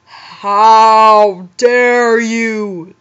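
very slow, loud drawn-out outrage; stretched demand. Acted. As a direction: drag out every word in cold fury. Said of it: "demanded Professor Snape".

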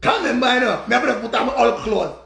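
normal talking voice

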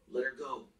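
usual speaking voice

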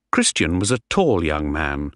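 usual speaking voice

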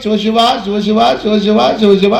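repetitive mock chant of a god's name; imitated praying murmur. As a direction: lightly act out someone praying at bedtime. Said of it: "ಅನ್ಕೋತ ಇವತ್ತು ಮಕ್ಕೋತಾರ".

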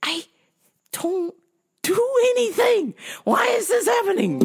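high, whining mock wail; hammed-up high-pitched protest; exasperated wail. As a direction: cry it out high, aggrieved. Hammed-up complaint about being picked on.

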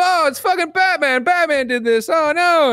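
high, loud hammed-up wail; mock panicked crowd. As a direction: cry out like a scandalized mob.

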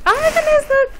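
high-pitched delighted squeal; excited squeal. Real, not acted. Unboxing a reborn doll for viewers, squealing with delight. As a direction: gush over the tiny blanket. Seeing the doll's blanket.

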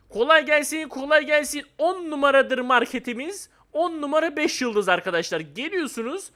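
mock shopkeeper's advert; playful market hawker. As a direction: pitch your shop like a street seller. Mock sales pitch for his market.

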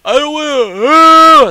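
talking through a big yawn; words stretched long, high and loud by a yawn. Real, not acted. Gamer yawning at his viewers mid-match, yawning through the words. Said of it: "sorry about that, yawn".

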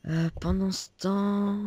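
cracking voice after throat clearing. Real, not acted. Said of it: "j'ai un peu la voix qui déraille". (voix qui déraille)

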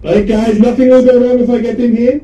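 high, loud hyped YouTuber intro; loud vlogger voice. Pretend. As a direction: hype it like a YouTuber.

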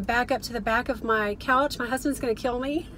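ordinary delivery

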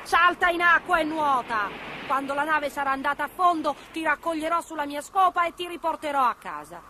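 high witch voice giving orders; commanding sea witch. Acted. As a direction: call instructions out to the cat.

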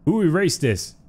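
ordinary talk, nothing marked

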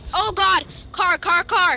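loud alarmed warning cry; urgent yell about the car; alarmed shout. Real, not acted. Car coming at them.

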